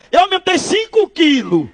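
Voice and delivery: high, drawn-out gloating; gleeful boasting. Acted. Pastor boasts to the man who cursed him, gleeful. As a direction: crow about it, stretching the words high.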